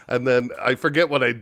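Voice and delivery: usual speaking voice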